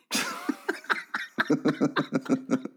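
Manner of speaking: long breath-filled laugh. Real, not acted. Joke teller after the duck punchline, amused. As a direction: crack up, mostly on breath.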